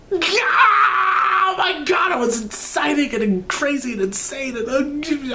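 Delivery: high, cracking shout of joy; excited shout. Real, not acted. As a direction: scream with fan excitement, pitch soaring. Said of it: "I'm stuttering".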